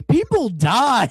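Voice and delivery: high, drawn-out mock outrage; incredulous reaction. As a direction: stretch it out in disbelief. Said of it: "I was like".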